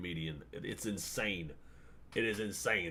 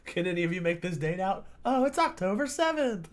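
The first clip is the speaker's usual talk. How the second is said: high, giggling parody of the streamer's question; mocking imitation; 11 semitones higher, 5 dB louder than usual. Mimicking the host's question to mock him, high and giggly. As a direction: repeat his line in a squeaky mocking voice.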